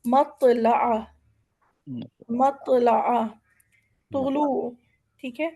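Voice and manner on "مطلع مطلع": مطلع with a heavy, thick ط; مطلع with a full heavy ط. Shown as a demonstration. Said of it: "طا کی آواز موٹی ہوتی ہے".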